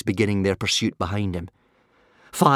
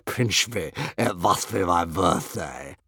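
slow, lowish blissful dragon; leisurely, gloating creature voice; breathed; 5 semitones lower, slower than usual. A narrator's reading. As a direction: savour every word with delight. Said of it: "breathed One Eye".

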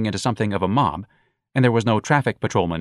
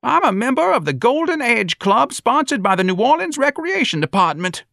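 very high, loud indignant protest; outraged old-timer's high-pitched boast; old man crying out; 5 dB louder than usual. A narrator's reading. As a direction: proclaim it at the top of your range. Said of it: "the old man cried".

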